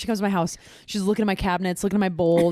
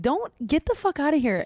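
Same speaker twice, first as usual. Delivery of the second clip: high-pitched mock outrage; acting out a scornful brush-off; indignant dismissal; 7 semitones higher than usual. Girlfriend brushing off her boyfriend in a retold spat, indignant.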